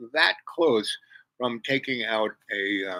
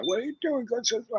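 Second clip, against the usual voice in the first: مقلّد لصراخ سائق; 13 semitones higher, 3 dB quieter, faster than usual. Retold or imitated. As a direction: imitate an irate driver screaming, pitched way up. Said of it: "was screaming at me".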